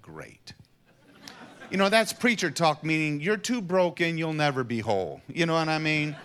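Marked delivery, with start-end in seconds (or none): 0.0-0.5 a flat, sinking, deflated sarcastic great. Retold or imitated. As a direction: let the air go out of it. Deflated reply to more inner work.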